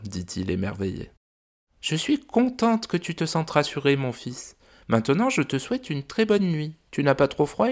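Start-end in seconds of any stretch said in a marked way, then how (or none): 1.8-7.7 high, gentle mother dragon voice; a fond, worried dragon mum. Acted. Mother dragon telling the visitors about her son, high gentle voice.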